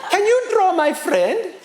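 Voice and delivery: high, slow, eager soldier's request; imitated soldier. Soldier asking for his friend's portrait, high and eager. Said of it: "give it to him and he goes".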